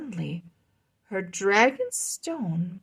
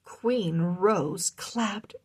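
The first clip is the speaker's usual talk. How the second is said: plain reading voice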